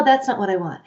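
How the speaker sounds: normal talking voice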